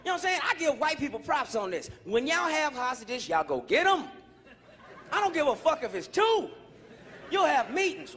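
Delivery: normal talking voice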